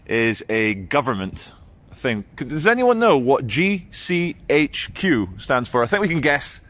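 normal talking voice

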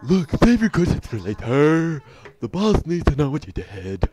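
loud, dopey henchman; stupid voice. Acted. Henchman brushing off questions for the boss, loud stupid voice. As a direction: belt it out as a dumb lackey. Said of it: "like, a really stupid voice".

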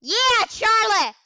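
loud high slow cheer; excited shout. Real, not acted. Gamer cheering teammate Charlie, loud high shout. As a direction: yell it out high and drawn.

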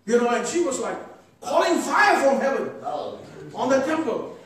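usual speaking voice

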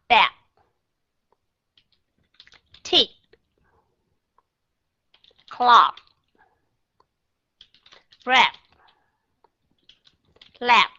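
each word stopped dead on its last sound; clipped final sounds. Shown as a demonstration. Teacher drilling single words, final sound held and stopped. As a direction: say each word and stop right there. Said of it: "Cho chót lưỡi với hai hàm răng và ngưng lại".

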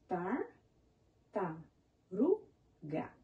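Tar-, ta-ru-ga spaced out slowly, one beat per syllable; slow syllable count. Shown as a demonstration. Teacher counting syllables with the children, slow and deliberate. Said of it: "Vamos contar?".

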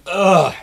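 loud, heavy mock groan; tired groan. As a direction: collapse into an exaggerated "ugh". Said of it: "we get tired".

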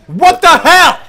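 high, loud shout of disbelief; outraged yell. Real, not acted. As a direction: yell it at the game. Outraged at the game.

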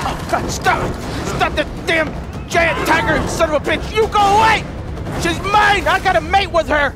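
loud high shouting at the tiger; loud high panicked shouting. Real, not acted.

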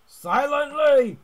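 high, loud, slow scream of the word. Real, not acted. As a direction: shriek it out in panic. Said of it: "Oh, I screamed".

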